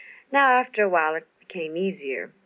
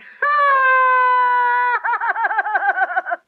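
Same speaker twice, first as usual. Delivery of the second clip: cackle; 7 dB louder than usual. Retold or imitated. Laughs after describing Phyllis Diller.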